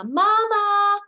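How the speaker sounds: high, loud call for mama; baby llama calling up high and loud for mama. Acted. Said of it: "calls down to his llama".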